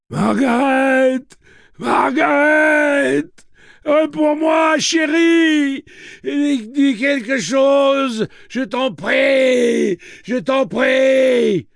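slow, high, desperate calling shout; hoarse calling. Acted. Wounded rancher calls desperately for his wife Margaret. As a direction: call your wife across the field, begging. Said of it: "appelant sa femme d'une voix rauque".

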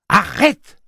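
cri très aigu et très fort; s'interpose en criant. Acted. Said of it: "s'écria Susanna". (Susannah crie pour arrêter Roland)

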